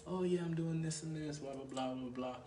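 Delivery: lightly voiced girl rattling off filler; girl's vague answer. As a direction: placeholder reply in a softened put-on voice. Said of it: "what are you about?".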